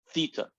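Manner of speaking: theta as THEE-ta, long "ee"; heavy E sound. Shown as a demonstration. Teacher repeating it for the class, theta with a heavy E. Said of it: "It's a heavy E sound".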